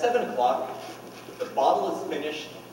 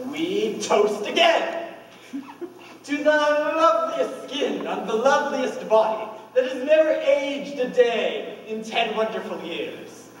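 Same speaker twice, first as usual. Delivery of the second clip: raised, rapturous toast with drawn-out words; exuberant toast; 8 semitones higher than usual. Retold or imitated. Husband retelling the anniversary toast to his wife, exuberant. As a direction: proclaim it high and adoring.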